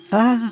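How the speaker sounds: startled yelp. Real, not acted. Startled by monster.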